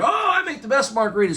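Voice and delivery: quick, high, showy boast; cocky brag. Retold or imitated. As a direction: toss it off like a proud bartender. Friend bragging about his margaritas.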